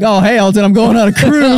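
high, mocking take on a friend; hammed-up high imitation; teasing imitation. Mocking what friend would have said.

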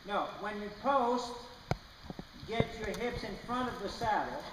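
usual speaking voice

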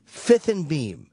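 "Fifth and Beam" repeated slowly, each word spaced. Retold or imitated. CFO repeats the address slowly for emphasis. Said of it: "He goes".

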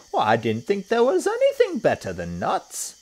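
very high, loud puzzled monkey; Mugglewump. A narrator's reading. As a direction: squeal it as a surprised monkey. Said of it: "said Mugglewump".

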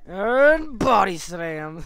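slow drawn-out body slam; loud wrestling call. Pretend. Announcing wrestling move.

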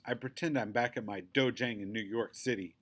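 ordinary delivery, nothing marked

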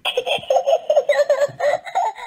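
high piggy giggle. A narrator's reading. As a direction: giggle in delight. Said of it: "says Peppa, laughing".

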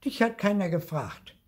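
high, pert put-down; snippy. A narrator's reading. Lucy puts eager Marti down, snippy. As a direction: cut them off snippily in a high voice. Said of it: "sagte Lucy schnippisch".

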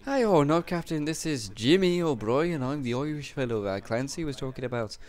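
high-pitched hammed-up Irish accent. Introducing himself as the prisoner Clancy mentioned, Irish accent. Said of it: "whenever I do an Irish accent".

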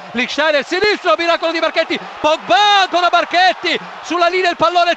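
rising, racing commentary; excited play-by-play. Real, not acted. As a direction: build the tension fast.

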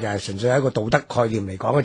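ordinary talk, nothing marked

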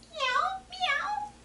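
very high, soft kitty meows; cat meowing. Retold or imitated. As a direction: mew like a little kitten. Voices the cat in picture book.

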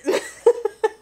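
a tipsy high-pitched giggle; high giggle burst. Real, not acted. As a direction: let a silly high laugh burst out. Laughs at her own tipsiness.

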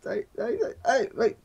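high frantic cries at the game; frantic yelling. Real, not acted. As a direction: panic as the goal comes. Desespero durante a jogada. (gritando desesperado)

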